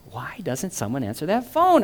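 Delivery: ordinary delivery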